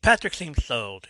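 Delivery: usual speaking voice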